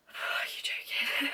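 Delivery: slow, whispered are you joking; slow, sighing whisper; whispered disbelief. Retold or imitated. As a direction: breathe it out in dismay. Dismay at section two.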